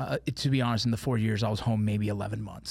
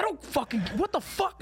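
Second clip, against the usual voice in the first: imitating his outburst, pitched much higher; retold outrage. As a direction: squeak out the disbelief, high and incredulous. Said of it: "I was like".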